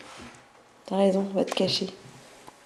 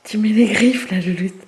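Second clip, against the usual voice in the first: sharp loud telling-off; loud scolding; 9 dB louder than usual. Real, not acted. Cat scratches her.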